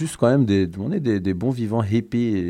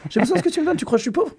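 high, indignant put-on voice, much higher; mock offended voice; 11 semitones higher, faster than usual. Acting out someone insulted by a gift, high mock indignation. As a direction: play a proud poor man who needs the gift. Voicing a proud recipient.

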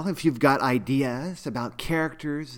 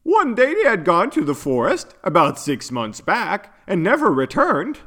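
high, plaintive character voice; sad voice; 3 semitones higher than usual. A narrator's reading. Yuno tells friends of missing parents, sad voice. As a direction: voice the unicorn's sorrow, pitched up. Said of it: "answered Yuno in a sad voice".

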